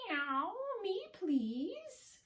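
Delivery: high, drawn-out cat voice; meowing cat. Acted. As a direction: plead like a mewing kitty. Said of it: "meows the cat".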